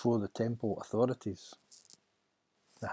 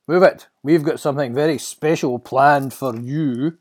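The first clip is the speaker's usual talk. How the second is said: loud, raised-voice order; menacing guard; 5 semitones higher, 11 dB louder than usual. Acted. Guard to his captured prisoner, menacing. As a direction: taunt the prisoner loudly.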